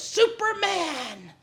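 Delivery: high, very slow drawn-out Superman cry; old prophet's cry. Retold or imitated. Preacher imitating old foreign prophet shouting Superman, slow and high. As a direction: stretch the word out grandly. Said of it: "that he goes".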